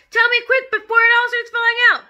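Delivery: high, loud frantic plea; panicked wailing. Acted. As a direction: beg for help before it's too late. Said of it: "wailed the father".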